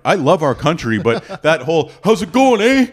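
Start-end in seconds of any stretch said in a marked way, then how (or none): 2.0-2.9 put-on loud, high-pitched, blunt Canadian accent; jarring Canadian accent. Comedian mocking how jarring home sounds, exaggerated Canadian accent. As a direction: blast it out as an in-your-face Canadian. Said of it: "it's jarring".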